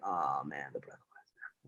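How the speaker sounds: whispered worry to himself. Retold or imitated. Drunk man remembering the breathalyzer, whispered.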